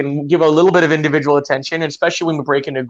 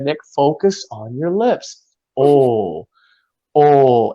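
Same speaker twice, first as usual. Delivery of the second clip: slow, deliberate instruction with long round "oh"; lightly voiced patient teacher modelling a vowel; coaching teacher; much slower than usual. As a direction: coach slowly, holding each "oh". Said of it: "And she said".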